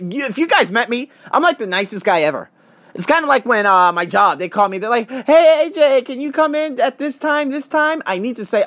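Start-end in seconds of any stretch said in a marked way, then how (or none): 5.1-8.0 high, loud chirpy boss voice; hammed-up boss calling to ask for a shift; pushy boss. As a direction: sing out the request like an overeager manager. Boss calling him in story.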